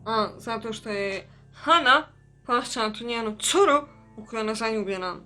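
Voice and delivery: ordinary talk, nothing marked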